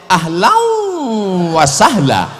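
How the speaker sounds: Ahlan wa sahla dilebur jadi ahlauw sahla; idgham berlebihan. Retold or imitated. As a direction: Ahlan wa sahla dibaca pelan dengan idgham. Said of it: "dimasukkan ke dalam". (Ahlan wa sahla dengan dengung ditahan)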